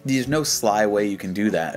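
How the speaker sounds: ordinary talk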